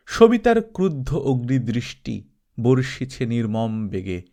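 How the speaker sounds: ordinary delivery, nothing marked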